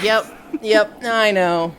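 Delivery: loud, cocky yep yep; overconfident insistence. Retold or imitated. The guesser insisting to Shockrail, loud and cocky. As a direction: brag that nothing gets past you. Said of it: "And they're just like".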